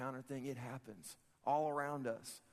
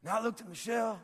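high-pitched impression of a preacher telling his story; Pastor Rick; 7 semitones higher, 4 dB louder than usual. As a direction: mimic your pastor, pitched well up. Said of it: "This is my best Rick impression".

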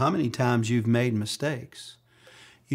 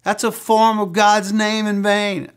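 much higher, louder outraged scolding; teacher scolding a boy, shrill and loud; 9 semitones higher, 9 dB louder than usual. Retold or imitated. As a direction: tear into the boy like a shocked Sunday school teacher. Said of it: "she came against me".

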